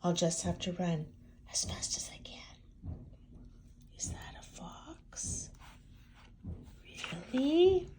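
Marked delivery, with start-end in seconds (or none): 1.5-5.5 breathy whisper. A narrator's reading. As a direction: whisper it with suspense. Whispered suspense.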